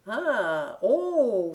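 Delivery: drawn-out, rising-falling exclamation; mild surprise. A narrator's reading. Mietje hears Gon wishes for a brother, mild surprise. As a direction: lift the voice in acted astonishment. Mietje reacts to the child's wish.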